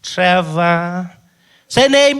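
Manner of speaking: high, loud, impatient demand; impatient clerk. Retold or imitated. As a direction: insist like an annoyed clerk.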